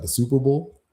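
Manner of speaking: ordinary talk, nothing marked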